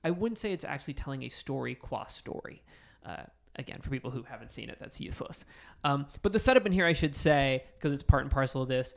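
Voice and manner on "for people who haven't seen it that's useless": under the breath, whispered; whispered aside. Real, not acted.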